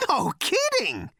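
very high, slow, loud yelp. Acted. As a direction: yelp with giddy delight. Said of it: "he yelped".

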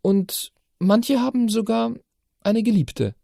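put-on high, slow, tentative girl's voice; hesitant. A narrator's reading. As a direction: say it half as a question. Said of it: "Das Letzte kam zaghaft".

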